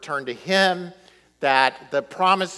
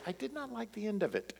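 soft, higher, quick quoted catchphrase; family catchphrase; 6 semitones higher, 12 dB quieter, faster than usual. Retold or imitated. Family quotes their movie verdict, soft and quick. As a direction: toss off the family line lightly. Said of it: "we always say".

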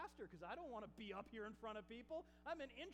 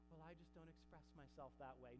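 soft, low imitation of a shy objector; mock reluctant worshipper; 7 semitones lower, 10 dB quieter than usual. Preacher imitates someone who won't shout, meekly. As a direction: drop right down, meekly excusing yourself.

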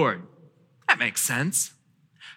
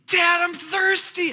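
put-on high kid whine; whiny child; 12 semitones higher than usual. Child whining to dad about thirst, high-pitched whine. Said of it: "if someone comes up to me and says".

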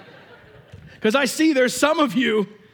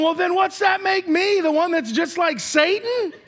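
hurt sibling; 6 semitones higher than usual. Retold or imitated. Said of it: "leaves the rest of them going".

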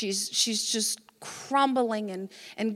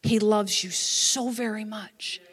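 tender assurance; 4 semitones lower than usual. Real, not acted. Preacher turns tenderly to congregation.

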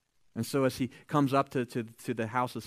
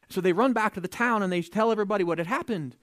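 ordinary talk

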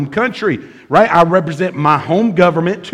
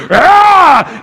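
loud, high-pitched roar; scare yell; 12 semitones higher, 6 dB louder than usual. Acted. Homeowner ambushing three door-knocking boys, roaring yell. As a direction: roar to terrify them. Acting his roar at the kids.

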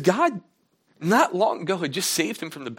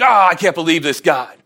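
mock-indignant outburst, louder; angry Jonah; 10 dB louder than usual. Jonah complains loudly to God. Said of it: "So Jonah's angry now".

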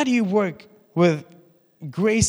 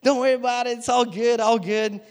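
breezy grace-person voice; 5 semitones higher than usual. Retold or imitated. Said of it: "They're like".

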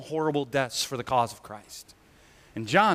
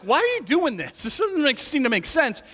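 high puzzled Pilate; 9 semitones higher than usual. Retold or imitated. Pilate voiced questioning.

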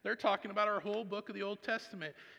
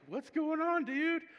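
much higher and louder, playful; excited greeting; 11 semitones higher, 6 dB louder, slower than usual. Retold or imitated. Dad greeting his beaming son in the morning, excited. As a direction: greet him like a thrilled dad.

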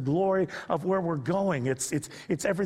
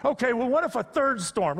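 mock challenging instructor; 7 semitones higher than usual.

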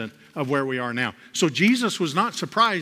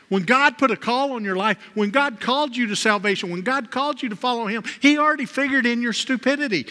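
fervent repeat; 9 semitones higher, 3 dB louder than usual. Real, not acted. Preacher to congregation, relishing the quote, excited and loud. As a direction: repeat it higher and louder, fired up.

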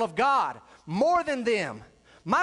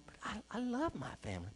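much softer, hushed aside; imitated meek objection; 12 dB quieter than usual. Congregation member telling the preacher they love family, meekly. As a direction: voice a sheepish churchgoer under your breath.

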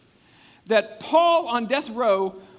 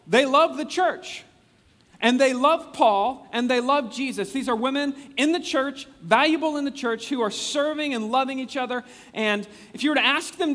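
preaching at full force; 6 semitones higher, 4 dB louder than usual. Acted. Preacher to congregation, rising loud preaching. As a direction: swell it up like a pulpit crescendo. Preacher builds praise of women.

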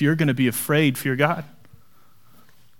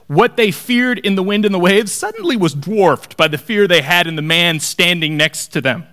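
ordinary delivery

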